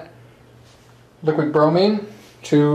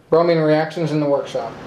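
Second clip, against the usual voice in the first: usual speaking voice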